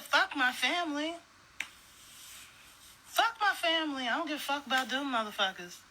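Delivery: high-pitched mocking quote; mock callous voter. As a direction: imitate someone carelessly dismissing their family. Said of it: "was you said".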